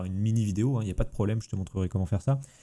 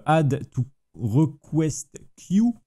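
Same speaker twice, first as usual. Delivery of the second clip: slow, higher-pitched put-on English accent; showy English accent; 6 semitones higher, much slower than usual. French streamer reading a menu option, in showy English accent. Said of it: "Quel accent, quel accent incroyable".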